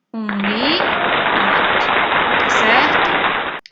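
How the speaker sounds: slow, loud, drawn-out answer; triumphant reveal. Acted. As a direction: announce the answer proudly.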